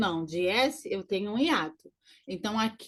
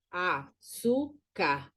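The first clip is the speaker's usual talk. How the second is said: very slow "Açúcar", pauses between syllables; 4 semitones lower, 4 dB quieter, much slower than usual. Shown as a demonstration. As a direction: spell out A, ÇÚ, CAR in "Açúcar", voice easing down. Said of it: "na segunda sílaba". ("Açúcar" pausado, sílaba por sílaba)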